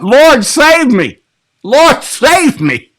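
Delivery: high, slow, pleading wail; desperate plea. Shown as a demonstration. Pastor showing how Peter really cried out, a high slow wail. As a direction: stretch it out like a drowning man begging. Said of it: "He didn't just say it like that".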